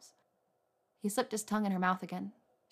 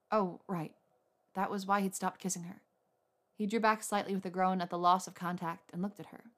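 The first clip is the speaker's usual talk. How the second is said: usual speaking voice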